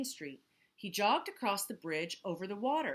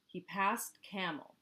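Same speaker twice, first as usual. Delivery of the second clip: plain reading voice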